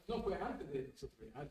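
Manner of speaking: ordinary talk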